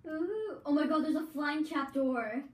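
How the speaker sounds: high, slow, amazed ooh; thrilled at a strange find; excited. Real, not acted. Sees a flying trapdoor in game.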